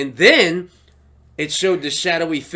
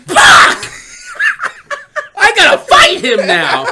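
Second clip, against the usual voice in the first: much higher, louder, laughing; frustrated outburst; 8 dB louder, slower than usual. Retold or imitated. Mocking Bo-Katan seeing the fight coming. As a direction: yelp it in mock dismay. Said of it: "She's like".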